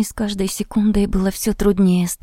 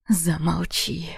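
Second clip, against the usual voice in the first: hoarse exhale; 3 semitones lower, 4 dB quieter, slower than usual. Acted. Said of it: "Сипло выдохнул".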